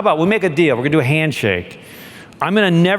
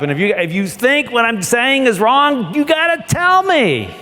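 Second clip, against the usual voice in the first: high pleading; 8 semitones higher than usual. Retold or imitated. Captain pleading with crew.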